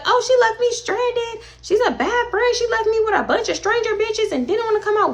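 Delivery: whiny complaint. Retold or imitated. Imagined friend complaining about being left, high and whiny. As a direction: whine it like a wronged friend. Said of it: "have nobody be like".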